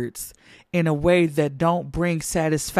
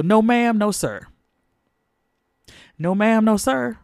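higher, firm pulpit denial; emphatic no; 5 semitones higher than usual. Acted. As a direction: scold the congregation playfully.

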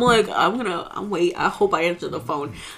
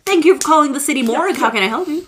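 mock receptionist; 6 semitones higher than usual. Mock morgue phone greeting.

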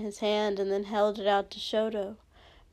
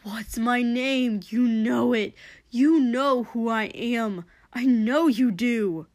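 loud, slow, pressing demand; heated, pleading insistence; urgent insistence; 5 dB louder than usual. Acted. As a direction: push each phrase harder, demanding recognition. Hero insists during fight.

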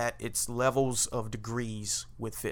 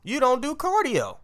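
incredulous people; 12 semitones higher, 4 dB louder than usual. Retold or imitated. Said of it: "everybody went".

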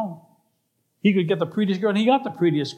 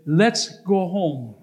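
very slow, deliberate order; measured demand; much slower than usual. Acted. Angry man to his girlfriend, slow deliberate demand. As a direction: draw out each word with controlled insistence.

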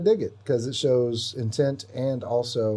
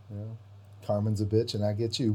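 normal talking voice

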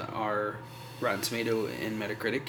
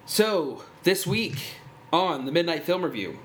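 upbeat, higher show-host intro; announcer voice; 6 semitones higher, 5 dB louder than usual. Acted. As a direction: sell the show opening. Podcast intro announcer read.

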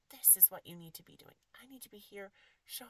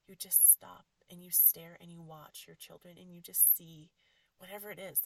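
ordinary talk, nothing marked

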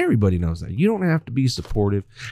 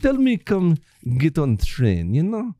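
put-on higher jokey German accent; mock German accent; 5 semitones higher than usual. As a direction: play a silly German accent. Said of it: "I love your German".

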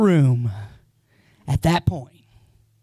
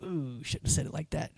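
regretful aside; 5 semitones lower, 15 dB quieter than usual. Retold or imitated. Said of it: "you're like".

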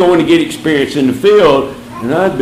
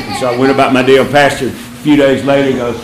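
ordinary delivery

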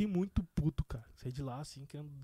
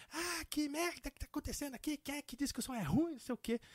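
mock angry complaint; 8 semitones higher, 5 dB quieter than usual. Organizer angrily complaining, retold.

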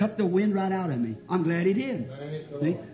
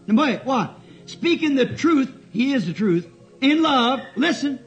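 raised, drawn-out pulpit delivery; preaching through scripture; 6 semitones higher, 4 dB louder, slower than usual. Real, not acted. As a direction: stretch the verse and press it on the congregation.

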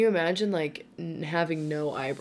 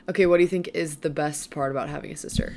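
usual speaking voice

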